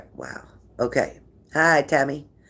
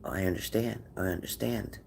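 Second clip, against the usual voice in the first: much softer, calm agreement; soft agreeing; 3 semitones lower, 9 dB quieter than usual. Shown as a demonstration. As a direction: murmur it softly to soothe an angry person. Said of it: "Just agree with them".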